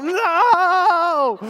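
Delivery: put-on high shouted childish no; sudden high loud refusal; kid's loud no. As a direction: yell it like a defiant seven-year-old. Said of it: "my seven-year-old is like".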